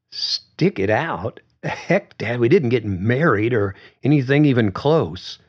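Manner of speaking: a hint of a reluctant young woman; young daughter Susan. A narrator's reading. Susan protests to her dad.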